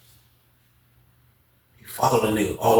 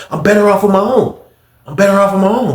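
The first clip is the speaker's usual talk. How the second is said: high, loud repeated insistence; imitated emphatic insistence; 9 semitones higher, 5 dB louder than usual. Guard insisting to the inmate, high loud emphatic. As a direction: press it harder each time, higher.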